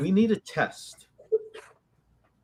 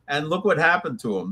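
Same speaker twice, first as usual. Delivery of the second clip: ordinary delivery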